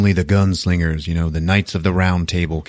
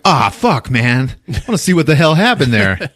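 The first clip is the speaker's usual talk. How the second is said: frustrated excitement; 5 semitones higher than usual. Retold or imitated. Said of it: "I was like".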